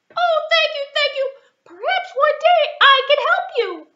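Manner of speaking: very high, loud, thankful squeak; a tiny mouse gushing thanks; grateful mouse. Acted. Mouse thanking the lion, very high and eager.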